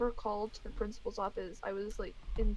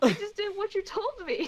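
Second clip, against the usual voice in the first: high, laughing mock-innocent protest; imitated protest; 9 semitones higher, 5 dB louder than usual. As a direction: protest your innocence, higher and a bit louder. Acts out protesting to teacher.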